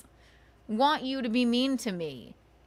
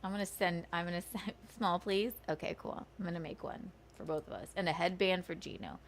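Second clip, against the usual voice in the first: much softer, half-whispered aside; quiet aside; 4 semitones lower, 9 dB quieter, faster than usual. Real, not acted. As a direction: drop the voice for an off-mic aside. Quiet aside to someone nearby.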